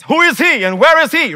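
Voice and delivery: high, loud outraged question; shouted royal demand; king's furious demand. Acted. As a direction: demand it like an enraged king. King's angry demand retold.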